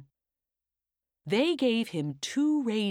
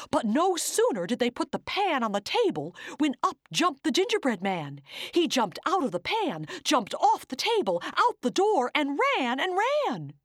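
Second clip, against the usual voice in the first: higher, building up; excited narration; 8 semitones higher than usual. A narrator's reading. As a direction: build the chase with rising thrill.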